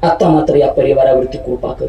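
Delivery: low, earnest prayer to God. Acted. Prayer for the family. (देवाकडे खालच्या आवाजात कळकळीची प्रार्थना)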